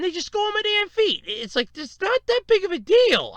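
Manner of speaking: high-pitched, incredulous griping; worked-up retold protest; exasperated protest. Protesting mom's fuss over laundry.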